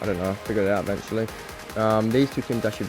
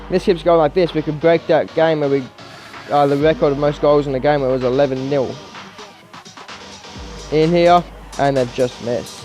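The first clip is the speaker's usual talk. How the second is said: higher-pitched, animated match commentary; live play-by-play; 6 semitones higher, 6 dB louder than usual. Real, not acted. Gamer calling his team's comeback, live play-by-play. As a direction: call each chance and goal as it happens.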